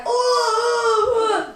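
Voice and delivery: high, wailing play-acted "oh"; mock agony cry. Babysitter playing along with laser attack, mock cry. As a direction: fake a wail of pain. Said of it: "And I was like".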